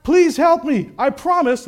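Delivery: high, loud acted cry; desperate plea. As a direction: cry up to heaven for rescue. Jack begs God for rescue.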